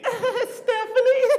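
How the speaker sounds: high, slow drawn-out giggly name; drunk girl voice. Retold or imitated. Drunk girl telling a man her name, high slow giggly voice. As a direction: purr it drunkenly.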